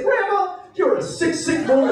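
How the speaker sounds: high, mock-disgusted scolding; a hammed-up look of disgust. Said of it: "I said".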